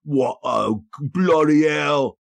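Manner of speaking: slow, high, put-on English accent; drawn-out English dismay over missing horses; another English accent. Groomsman finds horses gone, slow English accent. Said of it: "there's several I can do".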